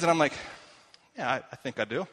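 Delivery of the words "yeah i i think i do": sheepish laugh. Retold or imitated. Bluffing about a slang term.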